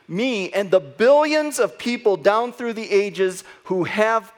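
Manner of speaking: loud, high, impassioned proclamation; full-force preaching. Real, not acted. Preacher proclaiming victory over death to the congregation, impassioned. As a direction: drive each phrase out, punching key words.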